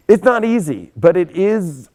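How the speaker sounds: loud and high, leaning on NOT; emphatic. Real, not acted. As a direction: punch "it's not easy" out with force. Stressing a hard truth.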